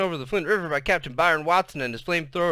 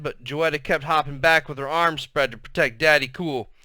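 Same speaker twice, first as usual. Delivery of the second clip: usual speaking voice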